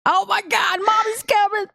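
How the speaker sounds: high-pitched excited fan outburst; fangirl squeal. Retold or imitated. Acting out fan excitement at entrance.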